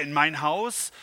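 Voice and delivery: loud, alarmed cry; shouting in alarm. A narrator's reading. The man hearing God will visit cries out. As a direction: cry out as if the news is too much. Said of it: "schrie er".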